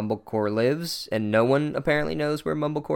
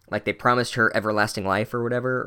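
ordinary delivery

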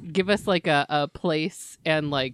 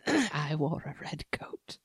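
whisper; 4 semitones lower, 5 dB quieter, slower than usual. Acted. Whispered joke.

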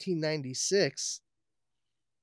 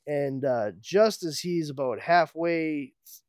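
ordinary delivery

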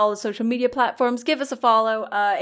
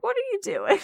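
mock disbelief; 11 semitones higher, 4 dB quieter than usual. Mock scolding a sword wizard.

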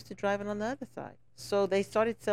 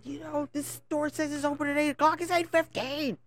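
a higher, complaining tourist voice; the fussy tourist, pitched up; impatient tourist; 8 semitones higher than usual. Retold or imitated. Tourist complaining to the passing lady, in a higher impatient voice. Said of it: "And the guy said".

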